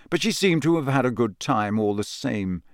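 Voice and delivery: plain reading voice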